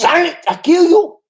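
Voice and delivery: high, loud puppet-style terrorist voice; Achmed the dead terrorist. Retold or imitated. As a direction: shriek it like a cartoon puppet. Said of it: "Aquaman, the dead terrorist".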